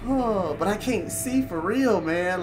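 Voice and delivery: ordinary talk, nothing marked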